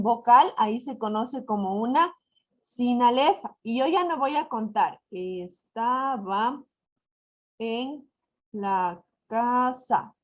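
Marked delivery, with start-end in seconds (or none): 5.1-10.1 deliberate slow line reading; slow verse reading. Shown as a demonstration. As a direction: read the line slowly, word by word. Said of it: "ya se cuenta como una sola sílaba".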